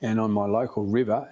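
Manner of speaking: usual speaking voice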